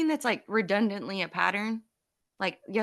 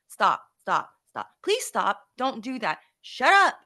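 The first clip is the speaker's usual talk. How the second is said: firm stop stop, loud shut up; telling kid to stop; 4 dB louder, slower than usual. Retold or imitated. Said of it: "I don't typically tell my kids to shut up".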